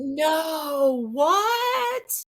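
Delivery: high, loud disbelieving cry of what; shocked cry. Retold or imitated. Hearing her red fog named, a loud shocked cry. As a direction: blurt it out in shock.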